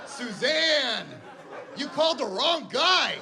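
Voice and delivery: high, laughing, indignant retort; indignant mock reply. Husband defends his backpack knowledge to the wife, high indignant retort. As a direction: protest your backpack honour. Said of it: "I'm just like".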